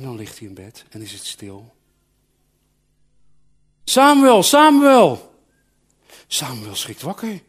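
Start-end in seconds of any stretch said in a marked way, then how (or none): 3.8-5.3 high, loud call from afar; a loud, high voice calling through the night. Acted. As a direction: call the boy's name across the dark. God calls Samuel at night.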